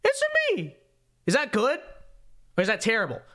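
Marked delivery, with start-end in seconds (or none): 0.0-0.8 very high squeaky Mario impression; high cartoon Mario voice. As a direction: pitch way up for the plumber. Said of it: "let's try this".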